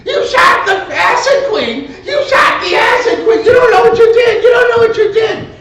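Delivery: high, loud frantic scream. Retold or imitated. Willie screaming at the robbers over the dead woman, high frantic yell. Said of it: "starts screaming".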